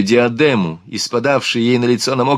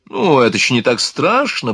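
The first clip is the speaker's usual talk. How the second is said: ordinary delivery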